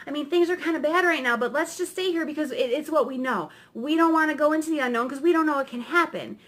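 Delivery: high, rushed, protesting cat voice; clinging worrier. Acted. Cat as comfort zone begging witch to stay, clinging. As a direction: plead anxiously to stay put. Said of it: "that cat is our comfort zone, and it's clinging".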